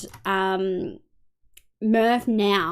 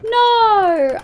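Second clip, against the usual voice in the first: high, loud cry of dismay; 11 semitones higher, 7 dB louder than usual. Real, not acted. Player finds her cookies gone, loud and high. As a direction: realise the cookies are gone.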